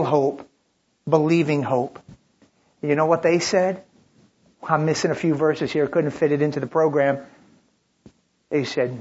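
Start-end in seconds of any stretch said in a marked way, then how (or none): none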